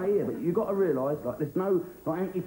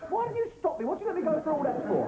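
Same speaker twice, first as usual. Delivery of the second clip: indignant protest; 12 semitones higher than usual. Acted. Ordeal was needless, indignant.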